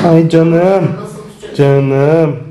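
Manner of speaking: tender cooing. Real, not acted. Cuddling the cat.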